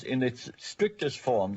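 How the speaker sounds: ordinary delivery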